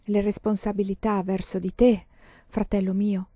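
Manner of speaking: ordinary delivery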